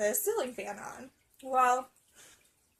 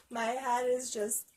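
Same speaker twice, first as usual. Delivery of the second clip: ordinary talk, nothing marked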